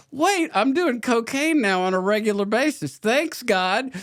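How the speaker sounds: high, mock-grateful sarcasm; sarcastic thanks. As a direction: throw a bitter thank-you up at God. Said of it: "I was like".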